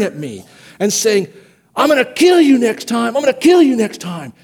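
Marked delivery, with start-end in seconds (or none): 1.8-4.3 higher, louder mock threat; half-acted angry yelling from the other player; retold yell. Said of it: "started screaming at me and yelling".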